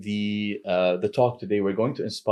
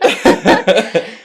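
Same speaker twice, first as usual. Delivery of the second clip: breathy high laugh; 11 semitones higher, 12 dB louder than usual. Real, not acted. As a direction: laugh through it. Host laughs.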